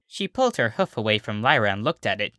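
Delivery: plain reading voice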